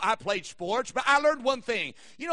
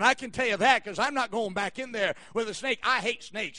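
usual speaking voice

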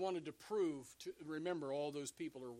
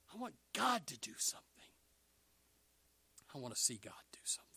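hushed, quick whispered prayer; whispered plea; much faster than usual. Acted. Believer asking God to act, urgent whispered plea. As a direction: let the plea fall away to a whisper.

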